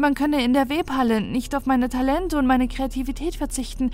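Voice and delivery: lightly voiced anxious girl; Omini. Omini speaks, worried.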